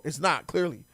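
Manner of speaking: usual speaking voice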